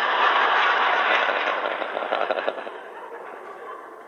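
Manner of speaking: a long, breathy laugh. Real, not acted. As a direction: laugh at the lightness of it. Laugh after the Zen story.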